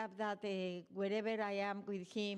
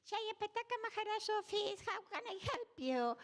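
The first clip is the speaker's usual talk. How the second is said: much higher, squeaky mimicry of her phone greeting; mocking imitation; 12 semitones higher than usual. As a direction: parrot her words back in a high teasing voice. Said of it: "So he starts mocking me".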